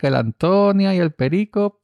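high, slow proclamation voice; town crier. Acted. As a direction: proclaim it like a herald. Mock town crier announcement.

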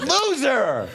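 high-pitched, drawn-out taunt; mocking jeer. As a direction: playfully heckle like a bystander. Mock heckle after the trip.

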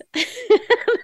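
short high burst of laughter. Real, not acted. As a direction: laugh at the absurdity. Laughing while telling story.

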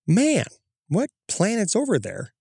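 high, baffled musing to himself; puzzled wonder. Retold or imitated. Man stargazing wonders aloud, high and puzzled. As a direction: wonder aloud at a strange light.

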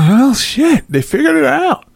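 high put-on voice of a rattled official; very high caricature of a politician; mock official. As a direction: play the bigwig realizing it. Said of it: "They sitting there talking about".

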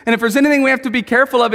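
ordinary talk, nothing marked

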